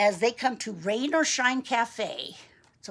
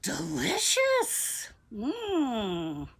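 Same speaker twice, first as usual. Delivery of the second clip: mama relishing; much slower than usual. Acted. Mama enjoying cafe treats, relishing. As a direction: draw it out with relish. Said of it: "the mama says".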